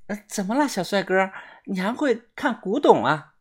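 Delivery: lightly voiced curious girl; teasing young woman. A narrator's reading. Girl questioning the narrator.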